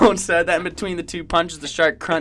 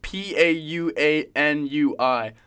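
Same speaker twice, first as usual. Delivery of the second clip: slow, letter-by-letter spelling; slower than usual. Real, not acted. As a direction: spell it deliberately, each letter separate. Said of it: "Spell it out".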